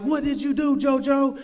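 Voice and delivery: high-pitched voice of a shocked aunt; scolding aunt. Retold or imitated. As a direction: question him like an appalled aunt. Aunt confronts him in retold story.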